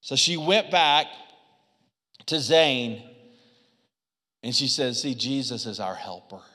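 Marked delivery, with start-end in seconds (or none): 5.0-6.4 put-on gentle to child. Mom comforting child.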